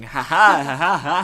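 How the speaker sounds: loud ha-ha laugh. Real, not acted. Host laughing at their own audiobook remark, loud ha-ha laugh. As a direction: laugh it out in a string of ha-has.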